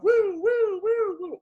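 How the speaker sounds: high, loud wailing woos; car alarm imitation. Storyteller mimicking the car alarms set off by the blast, siren-like woos. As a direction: whoop like a car alarm going off.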